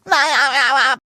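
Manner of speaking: bubbly cartoon laugh; short, warbling giggle; goofy laugh. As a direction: laugh in a rippling staccato.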